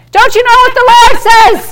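mock preachy nagging. Mocking believer nagging her husband about scripture, preachy. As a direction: lecture your husband like a know-it-all.

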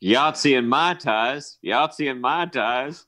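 slow Alabama drawl; Nicolas Cage's Alabama accent. Acted. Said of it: "to perfect his accent".

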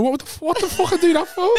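high, squeaky self-mocking voice; high and aghast at his own words; shocked at himself. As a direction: screech it like you've horrified yourself. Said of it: "like, going".

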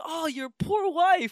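high-pitched, drawn-out mock sympathy; slow falsetto quote of fake concern; mock pity. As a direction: stretch it out high and pitying. Mock pity in a retold joke.

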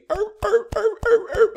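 loud, very high seal yelps; squealed seal-like barks; happy baby seal bark. Acted. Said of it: "like a happy baby seal".

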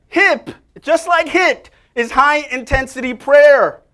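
high, loud, slow proclamation; preaching emphasis. Shown as a demonstration. Preacher proclaiming high-intensity prayer to the congregation, loud. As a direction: proclaim it to the whole room. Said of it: "Tell someone".

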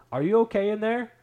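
retold calling out. Alarmed relatives calling through bathroom door.

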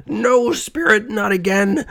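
high-pitched cry of dread; Scrooge. A narrator's reading. Old miser grabs the ghost's robe, high desperate cry. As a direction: cry out high, stretching the first words. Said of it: "With a terrible cry".

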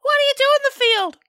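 put-on high, fast excited kid; a kid yelling a question fast; squeaky kid voice. Voiced curious kid caller.

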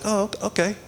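high, soft, taken-aback okay; hesitant acceptance. Retold or imitated. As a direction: stammer out an awkward little okay. Acts out taken-aback shopper's reply.

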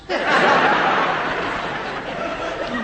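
long airless chuckle; breathy chuckle. Acted. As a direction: chuckle under your breath. Son laughs about paying a tenner.

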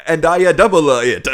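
loud, hammed-up mock Italian accent; bad Italian accent. Co-host mocks actor doubling down, in a bad Italian accent. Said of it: "This letter is written in an Italian accent".

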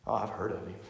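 very soft, low dismissive aside; barely voiced indifference; offhand murmur, acted. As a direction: toss it away under the breath. Casual people voiced, offhand.

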